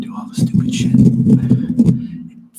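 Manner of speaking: voiceless whisper close to the mic; mock ASMR whisper. Shown as a demonstration. Streamer demonstrating silly decant ASMR to viewers, mocking whisper. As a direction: whisper it, making fun of ASMR creators. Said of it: "Just whispering".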